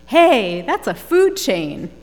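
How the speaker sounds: loud mock outburst, punched high on "Hey"; kids' loud exclamation. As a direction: call it out like an objecting kid. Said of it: "some of the older kids actually exclaimed".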